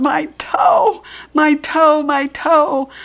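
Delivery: high, loud hollered "my toe"; a kid wailing in pain; hollering. Acted. Junie B hollering in the backyard over her hurt toe. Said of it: "I hollered real loud".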